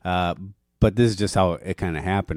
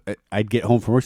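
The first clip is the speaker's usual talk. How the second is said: ordinary talk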